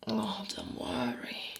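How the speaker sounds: slow, hushed, soothing murmur; soft reassurance. Acted. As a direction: soothe your partner, softly and slowly. Reassures his shy wife.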